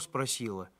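ordinary delivery, nothing marked

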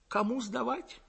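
soft, high, deflated question; crestfallen voice. A narrator's reading. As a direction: ask meekly, all the wind gone out of you. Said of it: "упавшим голосом спросил Гаврюшка".

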